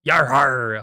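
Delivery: loud first stab at a pirate growl. Shown as a demonstration. Vlogger testing her pirate voice, loud amateur pirate cry. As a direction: try out a pirate cry, loudly. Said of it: "I can't do a pirate voice".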